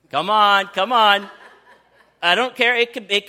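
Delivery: loud, playful coaxing; laughing urging. Real, not acted. Pastor loudly coaxing women to picture a TV crush. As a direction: egg the crowd on with a laugh.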